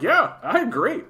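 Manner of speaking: high, exaggerated eager agreeing; retelling his own overdone agreement; mock eager agreement. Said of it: "And I'm like".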